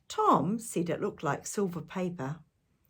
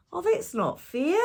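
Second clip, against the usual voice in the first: high, louder whiny complaint; little brother yelling; 11 semitones higher, 5 dB louder than usual. A narrator's reading. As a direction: complain like a small boy left behind. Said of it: "yelled Tom".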